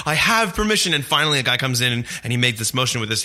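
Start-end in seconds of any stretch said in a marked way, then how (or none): 0.1-0.9 pitched-up insistent "I have permission"; exasperated traveller insisting to guards; insistent plea. Retold or imitated. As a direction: plead your case, voice jumping up high. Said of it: "And I was like".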